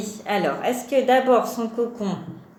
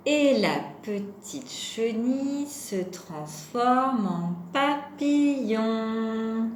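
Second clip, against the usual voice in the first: nursery rhyme recital; slower than usual. Acted. Teacher recites a caterpillar rhyme to children, slow sing-song recital.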